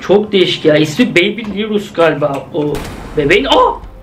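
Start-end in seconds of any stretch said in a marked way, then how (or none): 3.5-3.9 sudden very high yelp; startled gasp. Real, not acted. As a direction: caught by a jump scare. Scare in horror game.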